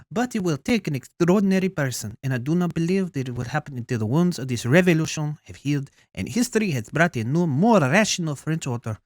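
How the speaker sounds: put-on emphatic French accent with a Russian slip; French accent turning Russian. Queen hoping the revolution's wounds heal, French accent turning Russian. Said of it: "getting a little Russian in there".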